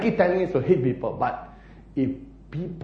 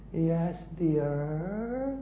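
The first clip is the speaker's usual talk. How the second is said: soft, drawn-out placating answer; mock compliant husband; 2 semitones higher, 8 dB quieter than usual. Husband answering the family he loves, mock meekly placating. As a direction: give in softly, stretching the last word.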